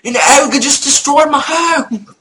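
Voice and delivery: high, loud mock-British wail; British accent. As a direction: cry out in a hammed-up British accent. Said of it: "I started talking like this British accent".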